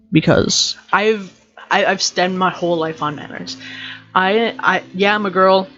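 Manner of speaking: normal talking voice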